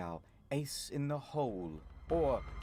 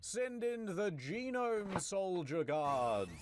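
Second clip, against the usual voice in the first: high, slow gloating villain; slow, high mock-villain taunt; hammy villain; 9 semitones higher, much slower than usual. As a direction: savour every word like a cartoon bad guy. Villain Ocelot voiced.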